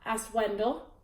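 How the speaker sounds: ordinary delivery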